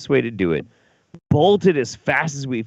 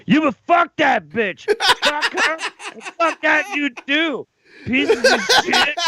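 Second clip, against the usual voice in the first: high, loud hammed-up drunk tirade, slowed and laughing; mock furious drunk yelling; drunk ranting; 3 dB louder than usual. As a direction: rant like a wasted friend at strangers. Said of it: "And you're like".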